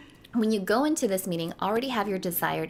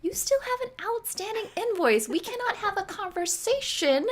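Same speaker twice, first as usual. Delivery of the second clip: sassy; 12 semitones higher than usual. Retold or imitated. Retold scolding of brand.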